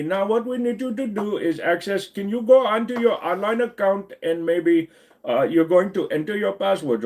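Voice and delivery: high-pitched Indian call-centre agent impression; Indian accent. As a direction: apologise and reassure in a put-on Indian accent. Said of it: "Do some Indian accent".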